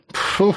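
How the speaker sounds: short puffed snort; dismissive snort. A narrator's reading. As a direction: puff it out in scorn. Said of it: "фыркнул Эбер".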